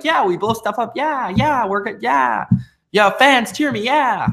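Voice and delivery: high-pitched parody of a cheering wrestler; mock cheerleader Cena. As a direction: bounce through it like a hammed-up cheerleader. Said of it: "cheerleader Cena ... he jumps up and down and he's like".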